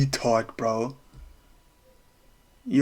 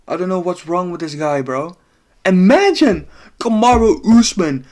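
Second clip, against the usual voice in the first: normal talking voice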